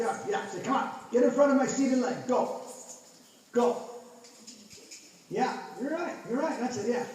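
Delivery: ordinary talk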